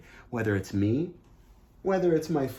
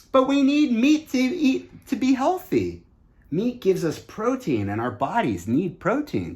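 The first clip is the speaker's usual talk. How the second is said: higher and louder, mock-confident objector voice; skeptics' claim; 10 semitones higher, 6 dB louder than usual. Young speaker mimics people who say we need meat, mock-confident. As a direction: put on the voice of someone arguing for meat. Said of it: "people say".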